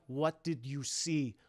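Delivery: low, slow, soft interrogation; cold villain's slow question; quiet menace. Acted. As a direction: press the question slowly as a threat. Esther threatens boy with blade.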